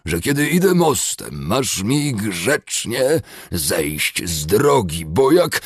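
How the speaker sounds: slow, loud, high snarling dwarf; rasping dwarf rage. A narrator's reading. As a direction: spit it out in a furious rasp. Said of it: "harczało". (charczący, wściekły krasnolud)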